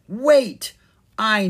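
loud, high burst; sudden jolt of recognition; startled realization. A narrator's reading. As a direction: blurt it out as it dawns on you.

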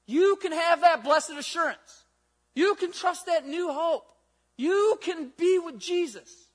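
slow, emphatic preaching, each line lifted. Acted. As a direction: build the promise slowly and firmly.

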